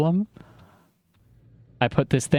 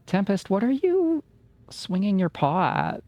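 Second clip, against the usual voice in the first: much higher, cooing at the cat; talking to the cat; 7 semitones higher than usual. Real, not acted. Streamer asks cat Tempest about her paw, high pet voice.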